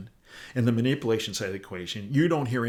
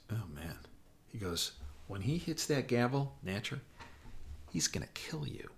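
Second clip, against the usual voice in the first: aide's hushed whisper, much softer; whispered warning; 10 dB quieter than usual. Retold or imitated. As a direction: breathe the warning so the chairman won't hear. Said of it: "unvoiced whisper".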